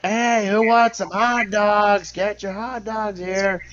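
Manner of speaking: high, slow crowd call; street vendor calling. Pretend. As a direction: hawk it to the stands. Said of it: "Just call out to the fucking crowd like".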